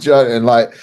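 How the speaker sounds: ordinary talk, nothing marked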